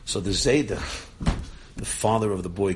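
ordinary talk

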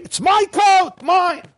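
mock shout of a child grabbing his coat; a child's retold yell, pitched high; retold scream. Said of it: "The boy was screaming".

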